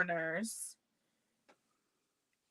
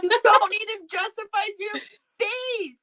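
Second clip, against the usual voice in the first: higher, louder, exclaiming over her; loud protest; 12 semitones higher, 8 dB louder than usual. Real, not acted. To her friend, loudly protesting the face disclaimer. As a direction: cut her off with a yelp of protest.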